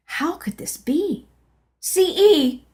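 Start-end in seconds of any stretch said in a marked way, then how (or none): 1.8-2.6 high, loud desperate yell. Acted. In pain, yelling to C E, a high loud cry. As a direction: call out for help at full voice. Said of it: "I yelled".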